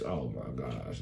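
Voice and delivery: ordinary delivery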